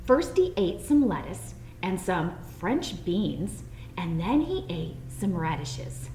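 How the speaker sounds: plain reading voice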